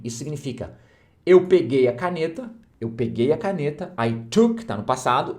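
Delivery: ordinary delivery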